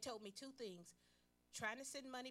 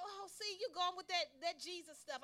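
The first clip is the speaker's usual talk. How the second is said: high, scoffing mock of the sister; 9 semitones higher, 6 dB louder than usual. Sister scoffs at her Jesus talk. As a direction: tease her for her faith. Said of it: "sister mocking on phone".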